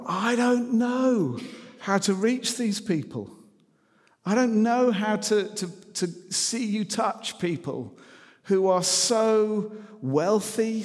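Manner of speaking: high, slow, aching plea to God; pleading prayer. Retold or imitated. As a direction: cry out to God, lost and helpless. Retold desperate prayer to God.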